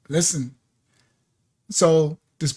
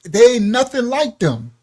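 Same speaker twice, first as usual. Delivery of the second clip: emphatic quote; 6 semitones higher, 6 dB louder than usual. Retold or imitated. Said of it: "it says".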